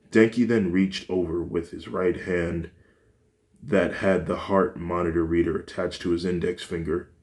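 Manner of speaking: plain reading voice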